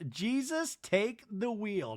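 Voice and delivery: a touch of theatrical dread; Mary. Retold or imitated. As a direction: draw it out in mock prayer. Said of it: "and Mary says".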